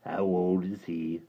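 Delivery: low gravelly creature voice; hideous creature speaking low; deep creature voice. Acted. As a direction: rumble it like a hideous lake creature. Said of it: "inquired the Mudlik".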